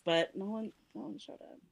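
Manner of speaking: hushed. Acted. Sad tale of empty party.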